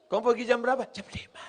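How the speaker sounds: sultry. Shown as a demonstration. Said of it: "Ini bersuara".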